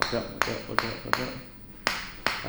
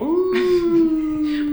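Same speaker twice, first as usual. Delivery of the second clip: high wolf howl; 8 dB louder than usual. Shown as a demonstration. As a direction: howl up at the ceiling. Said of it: "you're gonna howl".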